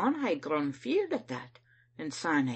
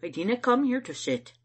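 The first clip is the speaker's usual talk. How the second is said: raised, indignant protest; angry refusal to be hosted; 8 semitones higher, 6 dB louder than usual. A narrator's reading. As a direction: object crossly, higher and louder. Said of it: "I said angry like".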